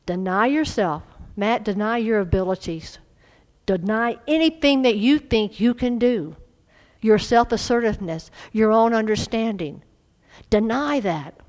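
loud, rising sermon exhortation; forceful preaching. Acted. Preacher exhorting the church to deny themselves, forceful. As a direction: preach it hard at the congregation.

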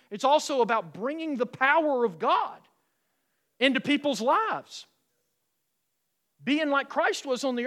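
loud, high, fervent preaching; full-force preaching. Acted. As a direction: preach it out to the whole church.

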